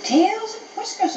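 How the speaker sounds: high-pitched, delighted exclamation; excited exclamation. Acted. Storybook dog catches a glorious smell, excited exclamation.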